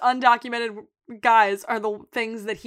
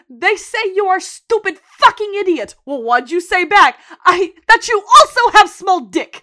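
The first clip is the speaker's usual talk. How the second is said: loud, high taunting character voice; hammed-up, punchy translation of insults; Svetlana; 9 semitones higher, 12 dB louder than usual. Imagining her translating the girls' insults to Mickey, mocking shout. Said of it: "think of Svetlana saying".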